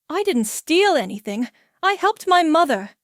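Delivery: loud, high defensive outburst; heated denial. Acted. Chris defending helping his mother, loud and high. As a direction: defend yourself loudly.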